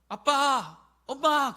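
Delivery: put-on loud, high child's call; child calling out for mom and dad. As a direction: cry out like a little kid.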